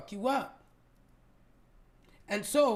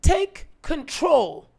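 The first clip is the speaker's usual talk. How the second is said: forceful push; 8 semitones higher, 9 dB louder, slower than usual. Acted. Self-talk motivational push.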